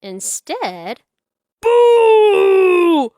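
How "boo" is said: loud, higher sudden boo; loud spooky boo; shouted boo. A narrator's reading. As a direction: jump out to scare. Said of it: "cried Spike".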